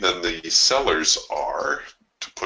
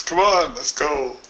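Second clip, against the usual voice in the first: yelling at a horse race; 8 semitones higher than usual. Real, not acted. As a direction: cheer your horse on. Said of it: "Feel like I'm at a horse race".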